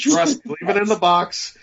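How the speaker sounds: advising younger self. Acted. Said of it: "I would have said".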